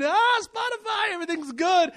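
high, loud mocking shout over blaring music; mock loud partier. Preacher mocking a crowd blasting Spotify with a loud, high shout. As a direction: shout it like a careless party-goer.

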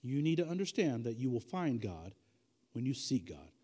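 slow, deliberate, low-pitched delivery; measured and low, with deliberate pauses; slowly. Real, not acted. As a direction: say it slowly so listeners can take notes. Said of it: "I'm gonna say them slowly".

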